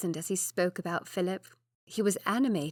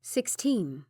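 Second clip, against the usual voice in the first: ordinary delivery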